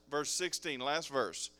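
ordinary delivery

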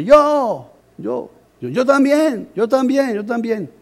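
high, excited yo también; eager volunteering. Retold or imitated. Boy eagerly answering the preacher, high and excited. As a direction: jump in eagerly, hand up.

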